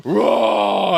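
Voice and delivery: drawn-out monster "raaw"; imitated creature roar. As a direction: roar like a big beast, playfully. Imitates Harry roaring back.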